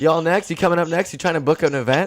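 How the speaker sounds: pushy salesman. Pretend. Pitching to old mourners at a funeral, eager mock sales voice. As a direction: hustle them like a funeral salesman.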